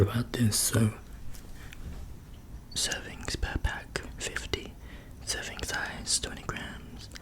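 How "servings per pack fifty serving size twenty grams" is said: soft unvoiced ASMR whisper; hushed ASMR murmur. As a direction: breathe it close to the mic. Whispering nutrition label.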